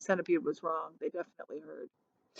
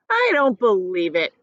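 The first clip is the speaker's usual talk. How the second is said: shouting; 4 dB louder than usual. A narrator's reading. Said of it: "shouted the centipede, raising his voice".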